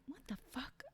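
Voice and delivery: breathy stunned whisper; shocked whisper. Retold or imitated. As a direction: whisper it in disbelief. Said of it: "I would be like".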